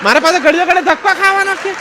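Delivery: high lady voice, laughing through it; high mock lady with a laugh; woman's voice. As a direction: deliver the lady's silly answer brightly. Lady continues in voice.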